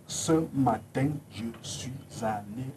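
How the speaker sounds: slow, syllable by syllable; sounding out words. Shown as a demonstration. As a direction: pronounce each syllable carefully. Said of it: "Un exemple, tout simplement".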